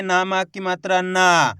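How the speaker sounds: final ना stretched on a long aa; drawn-out reading. Shown as a demonstration. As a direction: pull the last ना out long. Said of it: "तो खींच के पढ़ेंगे".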